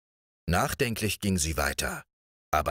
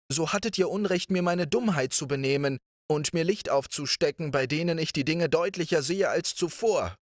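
higher princess voice, reasoning firmly; princess arguing; 8 semitones higher than usual. A narrator's reading. The clever princess reasons against marrying Riquet, higher. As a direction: argue your case cleverly, high and lively.

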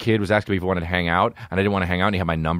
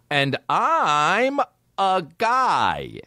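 slow punchline; 10 semitones higher, much slower than usual. Real, not acted. Punchline, drawn out in disbelief.

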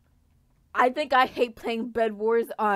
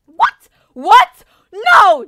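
high piercing disbelief; panicked shriek; shocked screams; 6 semitones higher, 17 dB louder, much slower than usual. Real, not acted. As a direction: scream as it all goes wrong. Bed destroyed, panics.

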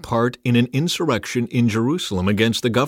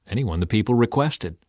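ordinary delivery, nothing marked

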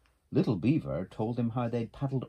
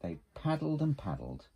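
ordinary delivery

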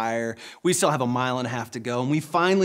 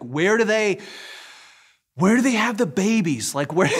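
frantic asking; 6 semitones higher, 4 dB louder than usual. Retold or imitated. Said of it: "said this out loud in the lobby".

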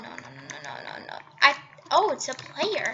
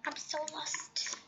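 soft whisper of confusion; a real quiet whisper; whispered to self; 19 dB quieter than usual. Real, not acted. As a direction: breathe it out, lost and resigned. Muttering to herself, confused.

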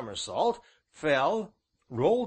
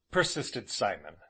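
plain reading voice

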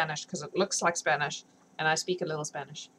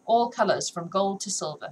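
ordinary delivery

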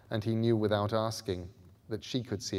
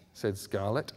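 plain reading voice

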